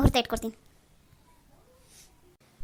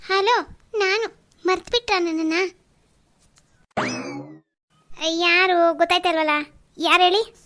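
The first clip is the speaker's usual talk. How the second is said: mock bride voice; 3 semitones higher, 5 dB louder than usual. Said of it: "ಯಾರನ್ನೋ ಇವಾಗ phone ಮಾಡಿ April pool ಮಾಡ್ತೀನಿ".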